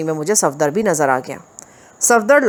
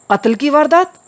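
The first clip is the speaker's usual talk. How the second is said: much higher, startled disbelief; shocked question; 8 semitones higher, 3 dB louder than usual. Acted. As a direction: gasp out the question. दोनों ने बैक वक्त बैक ज़ुबान होकर कहा. (चौंककर हैरानी से)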